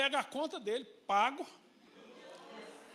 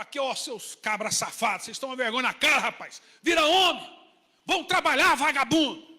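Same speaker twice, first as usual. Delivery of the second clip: loud angry rebuke of lazy men; angel scolding; 7 dB louder than usual. Retold or imitated. Angel scolds grown lazy sons, loud and angry. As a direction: bark at them like an angry angel. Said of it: "mandou lá o anjo pra Balaão com a espada".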